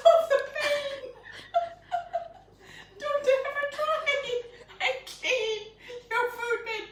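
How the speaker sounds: high, helpless laughter through the words; laughing so hard it squeaks; real laughing fit. Real, not acted. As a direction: talk while doubled over laughing. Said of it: "I'm still laughing".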